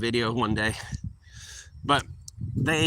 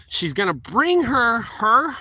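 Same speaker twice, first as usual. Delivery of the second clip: ordinary talk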